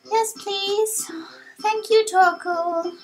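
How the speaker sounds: high, grateful youngster voice; polite young hero. Acted. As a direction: thank the elder in a high little voice. Young hero thanks the sage.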